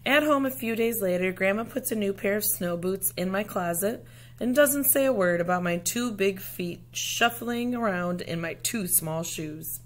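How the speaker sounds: ordinary delivery, nothing marked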